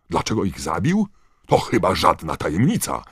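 high, loud, scornful roar. A narrator's reading. Knot roaring at Dumbledore, scornful. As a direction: bellow it back at him. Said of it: "ryknął Knot".

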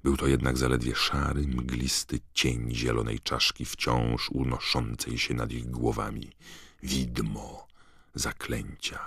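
plain reading voice

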